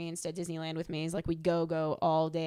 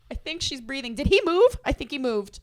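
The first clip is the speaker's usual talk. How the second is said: high, loud mock panic; jumpy, alarmed questions, pitch leaping up; retold fright; 5 semitones higher, 9 dB louder than usual. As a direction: act out being spooked by a figure. Acting out jumpy wax museum fright.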